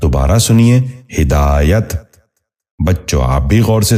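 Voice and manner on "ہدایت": ہدایت said as "hi-daa-yat", short i after h; correct pronunciation. Shown as a demonstration. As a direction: open ہدایت with "hi". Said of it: "درست تلفظ ہے".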